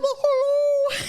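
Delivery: squeaky caught-out hello; high, loud, startled greeting. Retold or imitated. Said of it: "I turned to I'm like".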